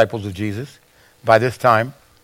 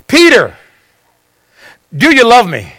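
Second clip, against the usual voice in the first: raised, pressing, falling at the end; insistent question; 7 semitones higher, 5 dB louder than usual. Acted. Jesus presses Peter for an answer, insistent question. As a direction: press the question firmly in a higher voice.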